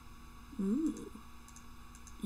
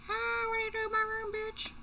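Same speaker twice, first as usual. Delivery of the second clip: high, fast mock shriek; startled naked person; 12 semitones higher, faster than usual. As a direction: pretend you've been walked in on, voice shot way up. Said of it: "there was a naked person there like".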